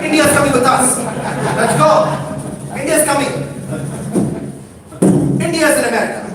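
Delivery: mildly hammed-up proud parents; immigrant parents. Parents bringing India to America, mildly hammed-up.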